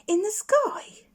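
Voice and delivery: amazed. Acted. Plop amazed.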